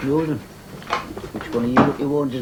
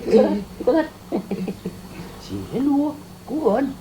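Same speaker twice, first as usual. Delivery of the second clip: much higher, playful coaxing voice; high baby talk; 9 semitones higher than usual. Acted. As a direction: coo at him like to a small child.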